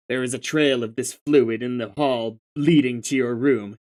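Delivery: normal talking voice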